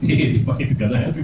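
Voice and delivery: laughing, affectionate ribbing; playful teasing. Shown as a demonstration. Teacher shows the same insult said kindly, laughing. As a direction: tease him as a friend, chuckling. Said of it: "लेकिन अगर कैसे बोलूं".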